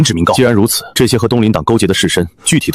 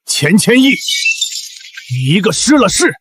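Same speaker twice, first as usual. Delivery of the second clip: angry tirade. Acted. Merchant's agent berates the fallen official, furious. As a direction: pitch climbing into indignant scorn. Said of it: "老郑消消气儿".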